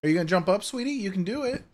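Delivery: high coaxing pet voice; sweet talk to the cat. Real, not acted. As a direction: gently coax the cat up.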